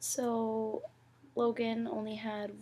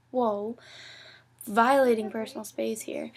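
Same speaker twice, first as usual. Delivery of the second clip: mock outrage; 8 dB louder than usual. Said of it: "and I was like".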